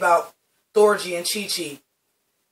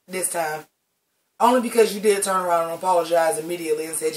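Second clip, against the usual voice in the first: ordinary talk, nothing marked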